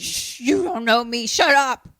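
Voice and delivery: snappy. Retold or imitated. Voicing Andy snapping at sheriff.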